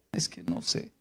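breathless whisper of a moved believer; hushed confession; whispered reply. Retold or imitated. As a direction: barely breathe the words.

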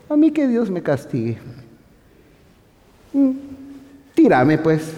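high, cocky challenge to God; mock insolence. Arrogant believer daring God to punish him, high and cocky. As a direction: sneer like a know-it-all.

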